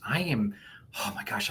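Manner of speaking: ordinary talk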